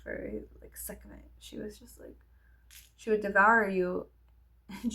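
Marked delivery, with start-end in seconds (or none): 0.0-2.2 much softer whispered aside. Real, not acted. Comparing the teacher to a goddess, whispered. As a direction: whisper the name. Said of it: "whispered aside".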